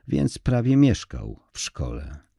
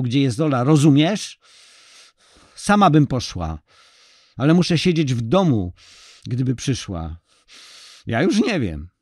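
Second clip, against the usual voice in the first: higher, louder, desperate pressing; wife losing patience over missing Ola, higher and louder; angry, strained; 6 semitones higher, 5 dB louder than usual. Acted. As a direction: push him hard, near breaking. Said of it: "wycedziła przez zęby".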